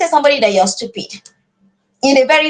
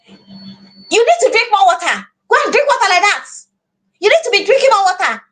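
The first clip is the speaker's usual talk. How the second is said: higher, bossy commanding tone; like an order; 6 semitones higher than usual. Shown as a demonstration. Parent ordering child to drink more water, commanding. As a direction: bark it as an order. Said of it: "It's like an order".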